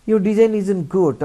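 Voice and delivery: loud, high, blunt put-down; blunt rude demo. Shown as a demonstration. Teacher shows the rude way to criticise a design. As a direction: say it rudely to hurt. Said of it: "আমরা এভাবে বলবো না".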